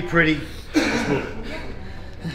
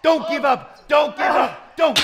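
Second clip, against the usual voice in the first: shouting coach; 10 semitones higher, slower than usual. Real, not acted. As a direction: drive them hard with yells. Trainer yelling during a set.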